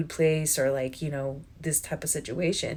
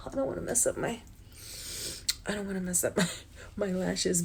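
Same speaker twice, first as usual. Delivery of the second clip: holding back tears; 2 semitones higher, 7 dB quieter, faster than usual. Real, not acted. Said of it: "I was getting teary-eyed".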